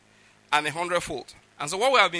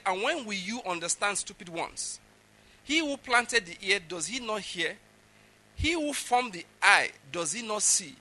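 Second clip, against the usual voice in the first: higher, slow proclaimed reading; scripture rebuke; 4 semitones higher, slower than usual. Acted. Preacher reading the next line to the people, proclaimed.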